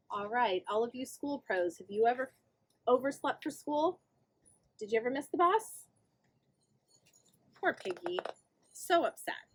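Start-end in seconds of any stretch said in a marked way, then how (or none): none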